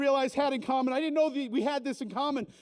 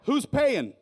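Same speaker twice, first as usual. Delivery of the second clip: loud call; 7 dB louder than usual. Acted. Punchline chant line.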